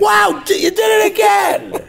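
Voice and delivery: high, shouted cheer; excited fan. Pretend. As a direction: shriek like a thrilled fan. Impersonating delighted audience member.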